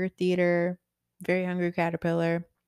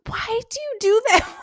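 exasperated mock; 5 dB louder than usual. Mother retells exasperated exchange.

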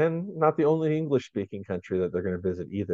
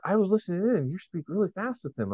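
higher, mock-surprised mom voice; imitating the mom; 11 semitones higher than usual. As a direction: playfully mimic the impressed mother. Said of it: "the mom is even like".

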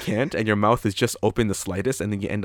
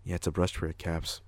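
softer, flat matter-of-fact reply; deadpan monotone; 3 semitones lower, 8 dB quieter than usual. Retold or imitated. At work, answering a customer in ShamWow's deadpan. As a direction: state the obvious with no expression. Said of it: "all you hear is this".

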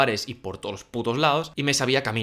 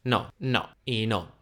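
slow stubborn refusal; much slower than usual. Retold or imitated. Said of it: "Esas eran sus respuestas".

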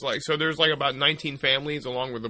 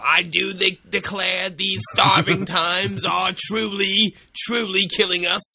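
put-on old-timey pilgrim voice; 5 semitones higher, 6 dB louder than usual.

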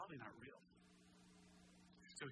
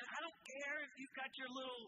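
preacher voicing the king's contempt; Nebuchadnezzar mocked; 7 semitones higher than usual. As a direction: sneer it like a haughty king. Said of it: "He was saying".

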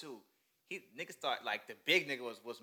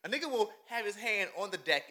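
incredulous; 7 semitones higher than usual. Real, not acted. Mocking anime's lucky draws.

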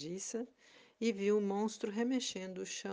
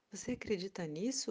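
ordinary delivery, nothing marked

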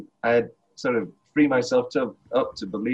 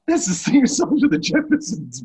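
high-pitched exasperation; 11 semitones higher, 3 dB louder than usual. Acted. Quoting a film objection to a co-host, high and exasperated.

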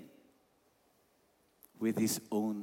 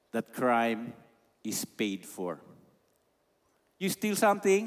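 normal talking voice

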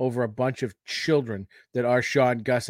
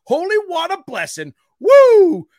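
builds to whooping high announcer hype; hype intro; 7 semitones higher, 7 dB louder than usual. Real, not acted. Host hyping up his cohost, escalating announcer whoops. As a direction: pump up the crowd as you introduce him.

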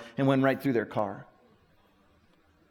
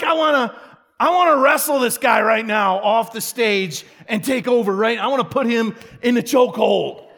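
higher, louder, half-shouted and laughing; mock rage; 6 semitones higher, 5 dB louder than usual. Pastor joking to the church about taking over the chapel, hammed-up. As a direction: joke about storming the stage. Joking urge to take stage.

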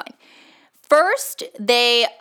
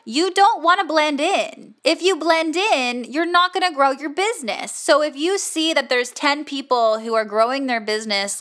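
ordinary delivery

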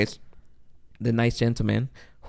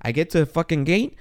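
ordinary talk

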